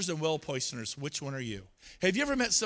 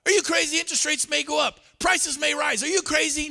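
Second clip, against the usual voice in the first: high, loud mock scolding of a client; hammed-up pushy agent, talking down; 10 semitones higher, 6 dB louder than usual. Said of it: "Now you're telling them they're stupid".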